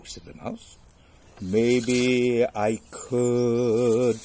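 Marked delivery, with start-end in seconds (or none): 1.4-4.2 loud, hugely stretched words; drawn-out pondering. Acted. Story figure pondering the offer, drawn out. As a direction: drag out every word, loudly.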